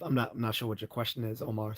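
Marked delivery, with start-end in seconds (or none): none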